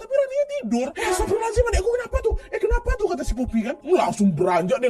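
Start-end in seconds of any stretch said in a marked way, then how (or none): 0.0-3.1 high, rising panic with a nervous laugh; alarmed voice climbing much higher; panicked. Retold or imitated. Poppy panicking at her sister being dragged, in a high alarmed voice.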